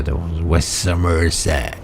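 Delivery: slow, hammed-up pirate accent. Reading a game poster aloud to viewers, mock pirate accent. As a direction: drag out "West Somerset" in a mock pirate accent. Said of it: "in his best pirate accent".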